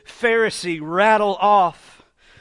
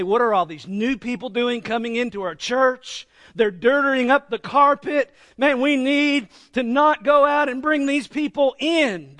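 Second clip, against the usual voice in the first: mildly indignant, louder complaining church member; a hint of a huffy Pharisee griping about newcomers; grumbling churchgoer; 5 semitones higher, 4 dB louder than usual. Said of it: "That is the attitude of a Pharisee".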